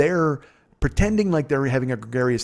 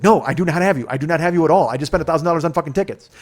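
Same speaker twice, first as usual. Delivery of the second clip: higher, louder refusal, speeding up at the end; exasperated retort; 5 semitones higher, 6 dB louder, faster than usual. Retold or imitated. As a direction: tell your friend off, loud and fast. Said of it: "And he's like".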